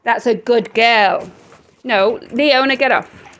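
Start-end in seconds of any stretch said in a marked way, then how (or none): 0.0-1.3 slow drawn-out praise for a good dog. Real, not acted. Owner praising Dina for finally sitting, slow praise. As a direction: reward her with your voice.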